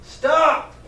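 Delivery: high, loud storybook shout; commanding shout. A narrator's reading. Chief frog stops the animals fighting, shouting. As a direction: shout it out to halt the squabble. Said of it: "shouted out".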